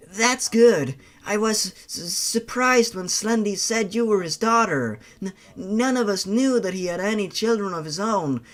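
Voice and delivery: nervous twitchy lad tripping on first sounds; Toby voice. Acted. Toby tells the girl he was surprised, stammering.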